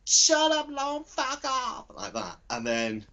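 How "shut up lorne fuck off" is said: high, loud mock mother shout; hostile mother. Mother telling her son to get lost, high mock shout. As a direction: yell it as a raging mum. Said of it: "She's like".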